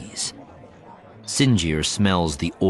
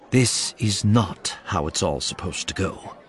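ordinary delivery, nothing marked

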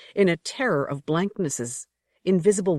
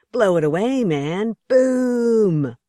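louder, higher mock gunfire boom; mocking shout; 6 semitones higher, 6 dB louder, slower than usual. A narrator's reading. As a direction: ham up the hunters' glee, building. Coyote mocks the hunters.

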